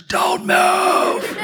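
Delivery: hammed-up urgent command in her ear; mock whisper. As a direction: whisper it so forcefully it becomes a shout. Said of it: "I just whispered in her ears".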